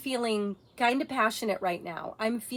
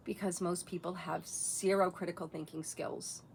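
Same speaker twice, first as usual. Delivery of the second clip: normal talking voice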